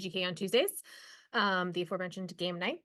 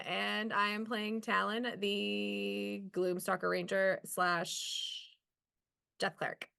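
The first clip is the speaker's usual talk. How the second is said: normal talking voice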